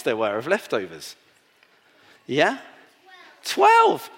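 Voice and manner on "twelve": high, loud, thrilled answer; excited reveal. Real, not acted. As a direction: announce it like a big surprise.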